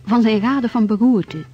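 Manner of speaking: ordinary talk, nothing marked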